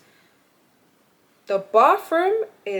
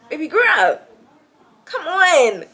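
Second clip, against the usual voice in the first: exasperated; 7 semitones higher, 7 dB louder, slower than usual. Real, not acted. Exasperated plea to roommate.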